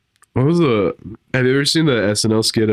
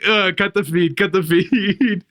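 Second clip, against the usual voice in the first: mission control panic; 8 semitones higher, slower than usual. Retold or imitated. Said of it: "they're like".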